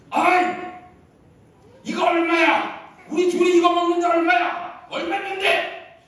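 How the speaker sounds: high, loud, drawn-out yell; shouting. Acted. Grandpa demanding the price in the dining hall, shouting. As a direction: holler it out to the whole dining hall. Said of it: "막 그러고 막 소리를 지르니까".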